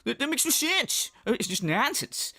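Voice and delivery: hammed-up indignant streamer; Phil impression. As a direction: imitate a streamer scoffing at a silly question. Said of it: "And he's like".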